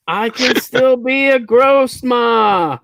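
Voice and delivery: high, slow ghostly mom voice. Retold or imitated. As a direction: wail it like a ghost mother.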